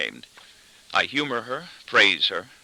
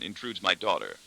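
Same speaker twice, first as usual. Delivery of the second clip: plain reading voice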